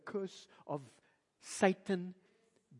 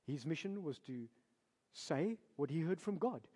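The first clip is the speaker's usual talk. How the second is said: ordinary delivery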